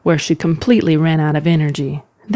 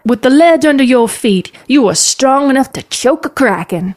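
higher, insistent calling voice; yelling encouragement; 7 semitones higher than usual. Acted. Aunt urges niece to choke kraken, calling out. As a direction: urge her on from the riverbank.

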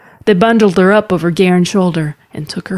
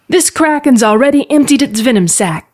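raised, higher-pitched urgent call; desperate call; 7 semitones higher than usual. Acted. Mother calls to daughter held by kraken, desperately. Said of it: "called Telvin desperately".

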